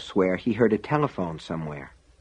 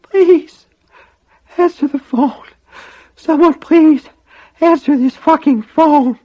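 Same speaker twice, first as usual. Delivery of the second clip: desperate, very high, breathless begging; strained, loud, screamy plea; screamy, breathless whisper; 8 dB louder, slower than usual. A narrator's reading. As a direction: plead with the last of your breath. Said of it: "whispered in a screamy, breathless voice".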